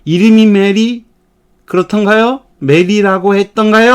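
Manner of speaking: 확신 없이; unsure. Shown as a demonstration. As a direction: sound unsure of the name. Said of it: "불확실을 표시하는".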